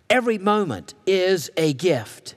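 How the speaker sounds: ordinary talk, nothing marked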